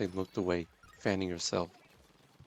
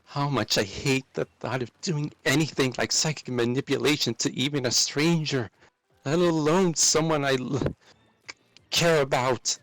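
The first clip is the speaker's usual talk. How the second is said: building from raised to much louder and higher; escalating shout; 5 semitones higher, 5 dB louder than usual. Acted. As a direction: shout over the feelings. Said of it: "I escalated my voice".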